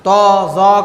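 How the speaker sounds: تو and زو slowly, with rounded, pushed-out lips; rounded letter demo. Shown as a demonstration. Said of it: "تو کہتے ہیں".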